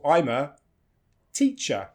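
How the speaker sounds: ordinary delivery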